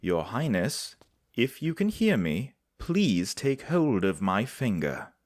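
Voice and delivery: calling out. Acted. Healer calling to the unconscious prince to grab her finger, loud urgent call. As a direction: call out to wake him, slow and clear.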